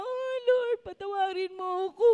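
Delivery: high, loud imitation of a sobbing plea; a penitent's loud, high cry for forgiveness; mock wailing. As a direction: wail it like a repentant sinner. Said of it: "lalo siya umiyak".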